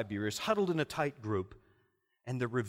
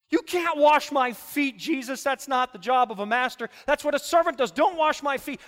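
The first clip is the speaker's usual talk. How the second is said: loud, slowed-down objection; loud protest; 11 semitones higher, 10 dB louder than usual. Acted. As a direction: refuse him loudly and with conviction. Peter protesting foot washing.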